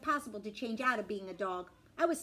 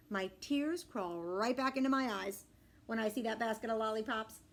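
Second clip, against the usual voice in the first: ordinary delivery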